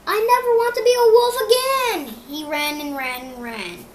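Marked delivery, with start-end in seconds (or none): 0.1-2.2 panicked cry. A narrator's reading. Fleeing scared dog.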